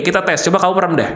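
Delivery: ordinary delivery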